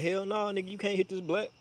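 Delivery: high, soft mocking refusal. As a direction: mimic the dude turning him down. Dude refusing again.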